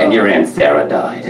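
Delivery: low, deadpan mom voice; imitating a flatlined mother; Rona. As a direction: break bad news with no feeling. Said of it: "or if somebody died".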